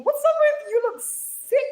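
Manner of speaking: very high, loud squeal of mock offence; mock indignation. Streamer teasing a viewer called mean, squealing in mock offence. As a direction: squeal it as if playfully affronted.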